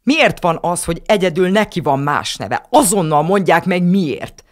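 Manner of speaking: put-on girl demanding an answer furiously; upset demanding. A narrator's reading. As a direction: keep yelling without stopping. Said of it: "nem is bírt leállni".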